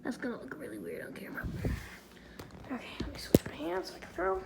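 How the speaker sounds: fast, unvoiced whisper; whispering to herself mid-trick. Real, not acted. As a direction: hush it while the camera rolls. Whispering during filming.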